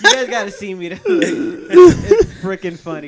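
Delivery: talking through loud laughter; words squeezed out mid-laugh. Real, not acted. Cracking up telling story.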